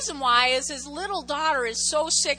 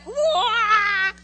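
mock wail; 8 semitones higher, 8 dB louder than usual.

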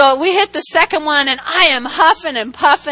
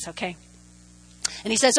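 ordinary talk

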